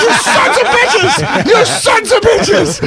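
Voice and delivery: very high, drawn-out cry of outrage; mock betrayed yell. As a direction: wail it in comic outrage at treacherous friends. Acting out his betrayed outburst.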